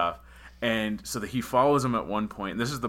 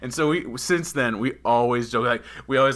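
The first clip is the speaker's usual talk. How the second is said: normal talking voice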